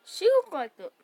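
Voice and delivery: excited outburst. Real, not acted. Reacting to the story, laughing.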